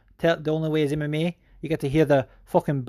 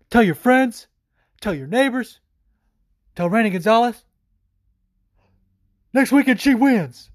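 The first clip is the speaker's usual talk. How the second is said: higher, louder rallying call, each line punched; rally the fans to your fighter; hype call; 8 semitones higher, 5 dB louder than usual. Real, not acted. Hyping a favourite fighter's win.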